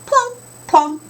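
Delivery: high, loud plunks; plunk sound. Shown as a demonstration. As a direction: pop each plunk out. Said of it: "Help me with those sound words".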